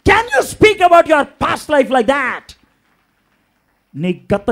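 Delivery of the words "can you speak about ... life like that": loud, high and very slow challenge; shouted altar-call challenge; forceful preaching. Acted. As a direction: hurl the question at the congregation.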